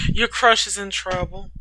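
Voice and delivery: plain reading voice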